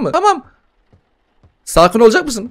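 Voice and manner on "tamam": put-on much higher, louder cheeky baby threat; a tiny brat swearing; squeaky baby voice. Gamer voices the demon baby cursing him, squeaky.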